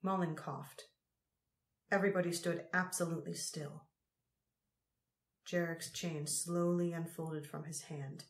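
plain reading voice

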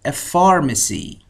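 pharmacy drawn out slowly, every syllable clear; slow model word. Shown as a demonstration. Teacher modelling a new word for students to repeat, slow. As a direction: lay pharmacy out slowly for learners. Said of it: "همراه من تکرار کنید".

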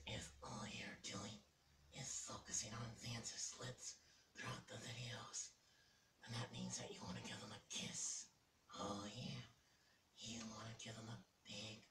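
slow, breathy conspiratorial whisper; teasing whisper. Acted. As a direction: whisper it like a naughty secret. Teasing viewers about lip-watching.

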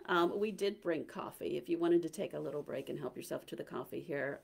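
ordinary delivery

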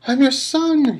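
very high, loud scream; desperate scream. A narrator's reading. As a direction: scream it up at your father. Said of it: "he screamed up at Crouch".

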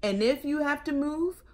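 put-on lively, loud read-aloud for children; storytelling read-aloud. A narrator's reading. Librarian reading a picture book to kids, lively.

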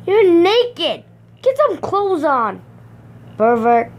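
high, loud outburst; high-pitched indignant exclamation; scandalized scolding. Real, not acted. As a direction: scold them in shock. Scolding naked game character.

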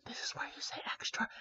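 breathy stage whisper to the audience. Real, not acted. Reader cueing the kids to answer, whispered. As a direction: lean in and whisper the cue.

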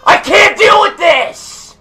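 furious screaming rant; raging gamer. Real, not acted. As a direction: scream in raw frustration.

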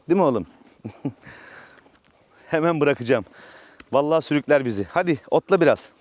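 ordinary talk, nothing marked

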